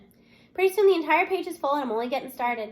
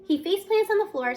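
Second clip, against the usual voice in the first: ordinary delivery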